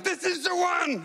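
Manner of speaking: high, slow yelled panic; panicking passenger yelling at a bump. Retold or imitated. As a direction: yell it out to the whole cabin. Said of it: "Stop yelling out, 'This is the one.'".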